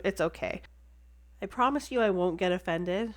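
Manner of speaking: usual speaking voice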